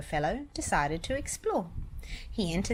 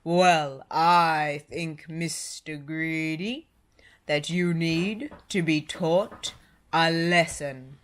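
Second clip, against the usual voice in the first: loud, slow giant voice; stern giant; 2 semitones lower, 5 dB louder, slower than usual. A narrator's reading. Giant telling Mister Greedy he needs a lesson, slow and loud. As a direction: lay down the law like a giant.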